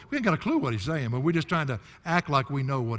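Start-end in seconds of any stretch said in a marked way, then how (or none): none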